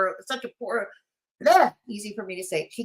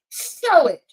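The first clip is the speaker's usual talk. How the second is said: a lightly voiced mock Bridget, a touch louder; Bridget impersonation; 3 semitones higher, 5 dB louder than usual. Streamer mimicking Bridget for chat, lightly voiced demand. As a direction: push it like Bridget demanding proof. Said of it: "I'm gonna do my best Bridget impersonation".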